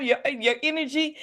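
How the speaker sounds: ordinary delivery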